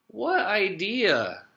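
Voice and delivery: high, drawn-out innocent question; feigned innocence. A narrator's reading. Nicholas plays dumb to his teacher, feigned innocence. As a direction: stretch it out, playing clueless.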